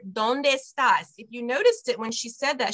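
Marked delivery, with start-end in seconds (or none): 0.1-1.1 ¿dónde estás? slowly, dónde and estás linked into one word; teacher models ¿dónde estás? joined up; words run together. Shown as a demonstration. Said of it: "another quick little note".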